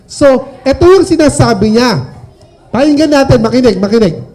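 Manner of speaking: ordinary delivery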